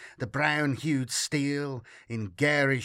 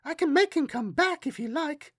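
put-on high-pitched child voice; little girl; 13 semitones higher than usual. A narrator's reading. Girl offers to summon her friend back, high child voice. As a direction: offer it innocently as a small child.

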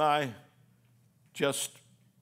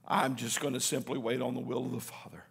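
soft, breathy surrender; quiet trust in the Father; whispered resolve; 4 semitones lower, 7 dB quieter than usual. Acted. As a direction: let it sink to a whisper. Jesus resolving to wait.

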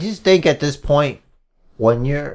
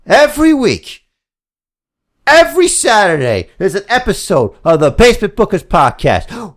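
wrestling promo; 3 semitones higher than usual. Acted. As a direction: cut a promo to the crowd. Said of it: "like I'm doing a wrestling promo".

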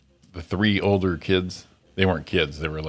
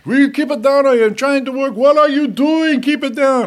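foreign neighbor's voice; 9 dB louder than usual. Retold or imitated. Neighbor yelling at his bratty kids upstairs, shrill falsetto. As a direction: shriek it in a strained high voice at the kids. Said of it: "and he'd go".

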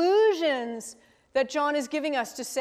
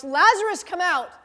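raised, higher call of command; loud shout; 5 semitones higher, 8 dB louder than usual. Acted. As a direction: call it out loudly, as if summoning someone. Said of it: "he shouted in a loud voice".

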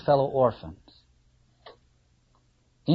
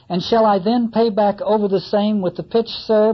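higher, louder eager carpenter; carpenter asking; 6 semitones higher, 5 dB louder than usual. Acted. Carpenter asking Ahab about the lid, eager and loud. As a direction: ask the captain keenly, hammer in hand.

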